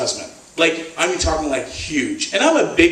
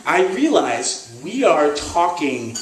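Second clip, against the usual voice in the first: ordinary delivery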